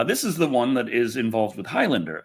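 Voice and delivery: ordinary talk, nothing marked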